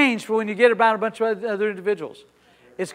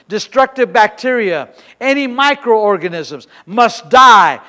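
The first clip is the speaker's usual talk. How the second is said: loud, emphatic faith declaration; forceful preacher reading out a confession; preaching confession; 4 semitones higher, 10 dB louder than usual. Real, not acted. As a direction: proclaim it with full authority.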